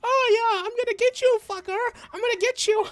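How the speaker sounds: high-pitched little rat taunt; high, cocky rat voice; threatening rat. Acted. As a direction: threaten as a tiny, squeaky rat. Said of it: "the rat going, goes".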